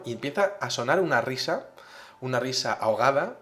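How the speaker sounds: normal talking voice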